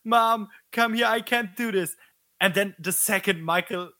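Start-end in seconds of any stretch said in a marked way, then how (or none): 0.0-1.9 whimpering fear. Retold or imitated. Karen baiting her mother from the basement, mock whimpering plea. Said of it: "whimpering and shaking and saying".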